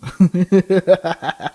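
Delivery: loud high burst of laughter; real hard laugh. Real, not acted. Cracking up at the game's psychopath laugh line. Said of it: "that's just me laughing".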